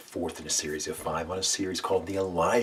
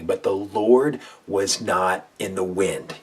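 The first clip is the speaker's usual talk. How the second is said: slow, loud, every word weighted; slow call-and-response; 2 semitones higher, 8 dB louder, slower than usual. Shown as a demonstration. Preacher leading listeners to repeat, slow and loud. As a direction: lead the congregation word by word. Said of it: "Can you say that out loud with me".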